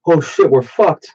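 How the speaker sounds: high, drawn-out mock dismay; mock waitress panic. Waitress bursting into the kitchen, mock panic.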